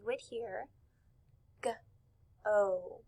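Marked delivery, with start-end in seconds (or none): 1.6-3.0 g-o split into "guh" and "oh" with a pause; sounded out. Shown as a demonstration. Teacher showing class the sounds in a word, sounded out. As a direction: say "g-o" as two separate sounds. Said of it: "If we were to blend the word go".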